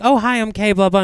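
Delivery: a light, bright mock hello; lightly voiced cheery hello; hypothetical greeting. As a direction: chirp a quick intro. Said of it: "if you were like".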